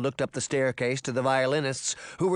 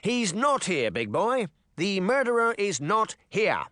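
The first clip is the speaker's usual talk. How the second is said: raised-voice denial, mildly shouted; higher, restrained shout; 6 semitones higher than usual. A narrator's reading. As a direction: call up firmly to a monster. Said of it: "Charming shouted as he turned".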